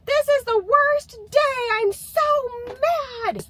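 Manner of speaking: high, mock tantrum scream of a little girl; imitating a screaming child. Mom imitating her daughter screaming from her room. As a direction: squeal it out like a four-year-old in a meltdown. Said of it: "she'll be screaming things like".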